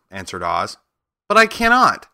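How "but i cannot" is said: high, loud surprised outcry; little girl crying out in disbelief; surprised exclaim. Acted. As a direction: blurt it out in shock. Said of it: "exclaimed Dorothy, greatly surprised".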